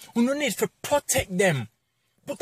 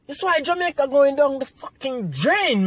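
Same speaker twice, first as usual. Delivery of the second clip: ordinary talk, nothing marked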